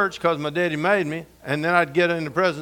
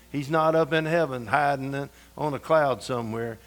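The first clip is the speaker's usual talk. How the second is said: normal talking voice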